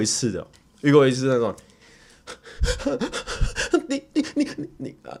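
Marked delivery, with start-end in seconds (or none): 3.9-5.2 high breathless stammering fan; panicked fan stuttering, half breathless; imitating flustered fan. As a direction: stutter like a starstruck fan who can't breathe. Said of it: "他好像就是快要呼吸不过来".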